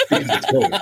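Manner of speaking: laughing. Real, not acted. Joke punchline, laughing through it.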